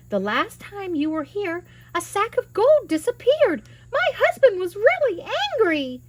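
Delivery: high, loud scolding wife voice; giant's wife. Acted. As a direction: scold the boy in a shrill housewife tone. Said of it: "she told him".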